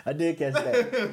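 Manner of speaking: high, slow through giggles; barely holding in a laugh. Real, not acted. Laughing at the clown promo.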